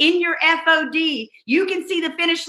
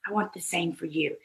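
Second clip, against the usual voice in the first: soft repeat; 7 semitones lower, 10 dB quieter than usual. Real, not acted. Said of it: "and I want the same for you".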